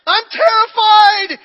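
high, loud, slow panicked cry; terrified scream. Retold or imitated. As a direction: scream it out in terror. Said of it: "she screams".

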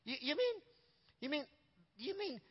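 high, faltering half-questions; halting astonishment. Retold or imitated. Little king grasps who they mean, halting astonishment.

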